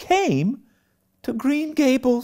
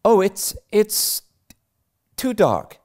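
put-on higher, halting girlish protest; mild anxious cry; 4 dB louder than usual. Anne balking at Marilla's evening errand, hesitant and anxious. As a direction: stammer out a reluctant excuse. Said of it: "cried Anne".

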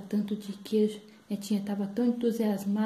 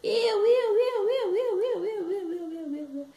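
put-on singsong child begging to be picked; clamoring granddaughter; 10 semitones higher, 4 dB louder, slower than usual. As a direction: start loud, let the chant tumble down and die away. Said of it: "aí ela".

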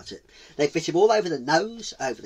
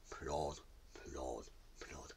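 low, soft, spaced-out plods; hushed trudging rhythm with pauses; slow plodding; 11 semitones lower, 20 dB quieter, much slower than usual. A narrator's reading. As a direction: drop each word like a heavy footstep. Imitating tortoise's slow trudge.

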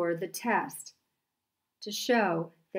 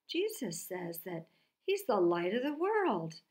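ordinary delivery

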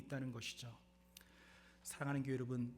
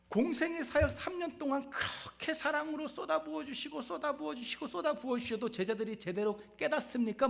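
much higher, loud preaching cry; impassioned preaching; 12 semitones higher, 6 dB louder than usual. Acted. Preacher to congregation, fervent high cry. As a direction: pour it out with rising fervor.

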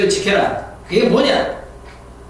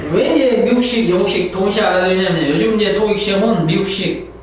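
usual speaking voice

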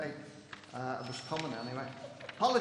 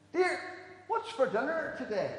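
high, raised call; 10 semitones higher, 5 dB louder than usual. Acted. Husband testing his wife's hearing from the hall, calling out. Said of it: "down the hall and shout".

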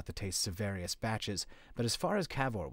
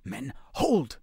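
snarling; 4 semitones higher, 4 dB louder than usual. A narrator's reading. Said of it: "Grotti snarled at his own men".

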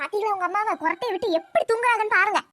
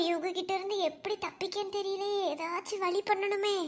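quiet aside; 5 semitones lower, 13 dB quieter than usual. Acted. Aside to self.

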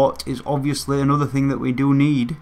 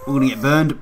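ordinary talk, nothing marked

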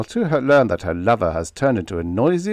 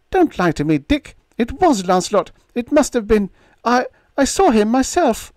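put-on high, pleading young woman; lightly voiced pleading girl; young woman's voice; 5 dB louder than usual. A narrator's reading. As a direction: insist through rising hope. Narrator voices Diane.

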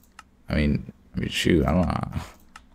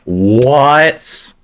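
surprised; 9 semitones higher, 7 dB louder than usual. Real, not acted. Sudden surprise in fight.

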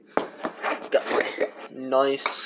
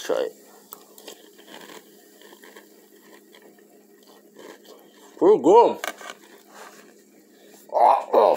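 talking between bites; chewing cereal; 9 semitones higher, 7 dB louder than usual. Real, not acted. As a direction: mouth partly full. Seen 0: Lips pushed out, cheeks working while eating from bowl; face leaves frame.

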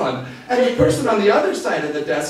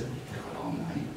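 "We've got all night" purred low and soft, much lower; low sultry murmur; 11 dB quieter than usual. Retold or imitated. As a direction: drop low and soft, teasing. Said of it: "she leaned across and she said".

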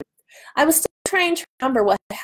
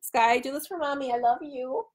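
tender coaxing; 4 semitones higher, 7 dB quieter than usual. Real, not acted. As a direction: sweet-talk the cat. Coaxing her cat tenderly.

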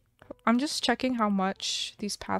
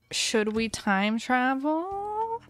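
slow, drawn-out repeat of the question; much slower than usual. Shown as a demonstration. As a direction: stretch every word of "Should we time travel?" out lazily.

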